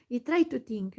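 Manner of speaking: ordinary delivery, nothing marked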